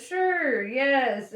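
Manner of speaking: loud, high cut-in; hurried interruption. A narrator's reading. Ford cutting off the guard's bellow, hurriedly. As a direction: jump in over the bellowing. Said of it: "interrupted Ford hur-, hurriedly".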